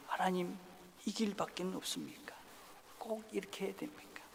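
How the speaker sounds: soft, whispered questioning of God; hushed plea. Acted. Jesus quietly asking God if there's another way. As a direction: ask almost under your breath. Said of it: "하나님께 지금 묻고 있는 겁니다".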